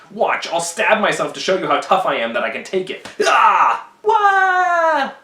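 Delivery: a comic pained shriek after a bold boast; mock stab cry. Portia stabs herself to prove toughness.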